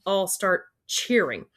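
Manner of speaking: ordinary delivery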